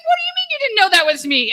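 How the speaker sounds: high, loud, fast disbelief; can't believe she didn't recognize him; incredulous friend. Retold or imitated. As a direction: friend amazed his prank wasn't spotted. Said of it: "He's like".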